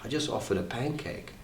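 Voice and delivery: very soft, wounded protest; hurt, tearful. Acted. Son in tears after father shouted, tiny hushed protest. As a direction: shrink to almost nothing, acting the hurt son. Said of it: "I've got tears running from me".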